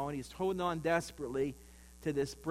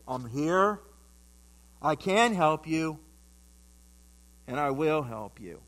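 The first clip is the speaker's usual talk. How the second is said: lightly voiced God, slow and measured; a hint of a solemn, steady voice from above; low voice; 6 dB louder, slower than usual. God answers the man on the cliff, lightly acted low voice. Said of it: "he heard this low voice".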